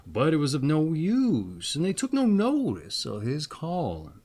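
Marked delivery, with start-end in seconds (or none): none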